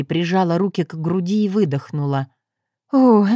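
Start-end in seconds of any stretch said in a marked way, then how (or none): 2.9-3.3 delighted gasp. Acted. Customer overjoyed by prophecy.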